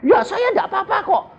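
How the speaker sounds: high-pitched imitation of a protesting caller; innocent protest. Woman insists to the preacher she did nothing, protesting.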